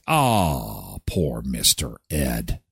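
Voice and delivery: low, slow, hammed-up pity; mock mournful sigh. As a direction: sigh over the poor horse, exaggerated. Mock sad for the horse.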